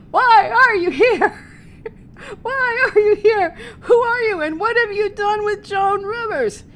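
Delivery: high loud jeer broken by laughter; laughing mock yell. Teller mimicking hecklers, laughing loud mock yell. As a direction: yell it while cracking up.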